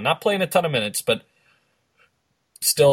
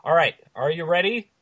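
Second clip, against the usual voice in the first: ordinary delivery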